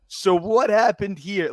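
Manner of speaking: high, slow mock manager question; stern manager. Manager questioning the two about the video, mock stern. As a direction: play the boss pretending to be puzzled. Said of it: "they were like".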